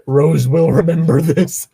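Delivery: game narrator voice. Pretend. Game quote parody.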